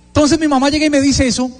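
usual speaking voice

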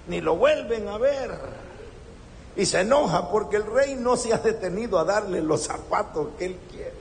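normal talking voice

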